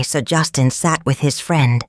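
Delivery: plain reading voice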